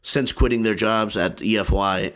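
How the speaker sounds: normal talking voice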